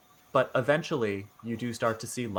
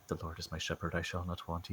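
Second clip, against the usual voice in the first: quiet muttered prayer; 6 semitones lower, 13 dB quieter than usual. Retold or imitated. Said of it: "he might just be like".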